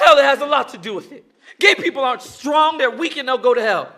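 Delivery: loud, high, laughing mock sermon; mocking rant. [name] mocking what he was taught, loud and laughing.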